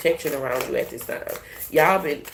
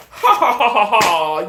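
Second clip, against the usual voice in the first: mocking laugh; 2 semitones higher, 6 dB louder, slower than usual. Shown as a demonstration. Showing viewers how to laugh at haters. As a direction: laugh right in their faces. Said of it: "laughter is the easiest way".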